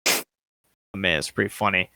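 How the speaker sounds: ordinary delivery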